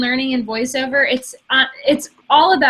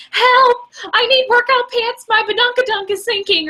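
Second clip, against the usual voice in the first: much higher, panicked mock plea for help; frantic ad customer; 11 semitones higher than usual. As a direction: squeal it like a desperate woman in a silly radio ad. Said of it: "one of the lines is like".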